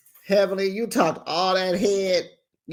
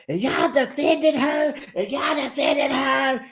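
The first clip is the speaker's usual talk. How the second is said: high, drawn-out imitated shout; hammed-up high-pitched, slowed yelling; mock outraged yell; 9 semitones higher than usual. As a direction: holler the accusation way up high. Imitates Contessa yelling at the group.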